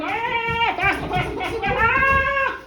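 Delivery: rising to a high scream; hammed-up terrified yell; mock panic. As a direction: build to a wild spinning shriek. Hamish spinning ever faster, screaming.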